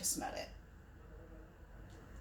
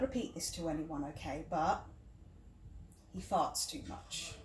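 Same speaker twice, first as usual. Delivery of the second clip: reluctant confiding; 7 semitones lower, 9 dB quieter than usual. Acted. Confiding an embarrassing secret.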